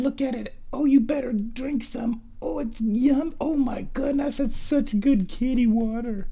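high cooing pet voice, half whispered; cat talk. Real, not acted. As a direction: coo the cat into drinking. Coaxing cat, partly whispered.

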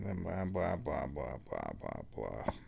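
fading blah blah. Real, not acted. Telling viewers the night repeats, fading to a murmur. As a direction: let the blahs sink away into near silence. Skipping repetitive part of story.

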